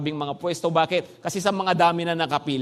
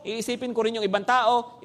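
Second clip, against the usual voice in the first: ordinary talk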